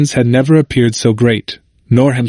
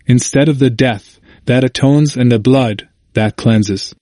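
ordinary delivery, nothing marked

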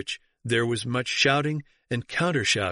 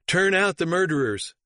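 higher-pitched, emphatic outcry; mild narrated shout; 6 semitones higher than usual. A narrator's reading. Bishops of the East demanding expulsion, mild shout. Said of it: "shouted out".

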